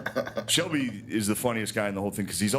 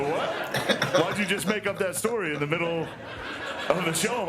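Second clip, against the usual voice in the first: higher, baffled protest with a laugh; mock disbelief; 7 semitones higher than usual. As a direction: call out a made-up story, incredulous. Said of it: "You go".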